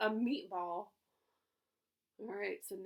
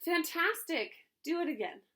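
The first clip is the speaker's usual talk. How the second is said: shouted; 7 semitones higher, 6 dB louder than usual. A narrator's reading. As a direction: cheer him on to go again. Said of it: "Elizabeth shouted".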